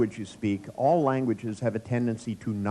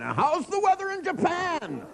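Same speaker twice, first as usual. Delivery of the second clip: much higher, slow mock shout into a phone; mock phone yell; slower than usual. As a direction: imitate a man bellowing on his cell. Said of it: "the guy kept screaming".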